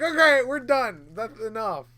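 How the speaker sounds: acted-out indignant refusal; fed-up protest. Retelling his fed-up protest after bite.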